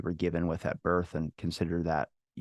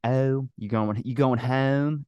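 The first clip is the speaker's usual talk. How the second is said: exaggerated Maryland "home", drawn out and higher; mocking Maryland accent; 4 semitones higher, much slower than usual. Buddy making fun of his Maryland talk, mocking Maryland accent. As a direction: tease with a stretched Maryland accent. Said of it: "likes to make fun of me".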